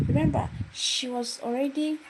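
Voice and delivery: ordinary talk, nothing marked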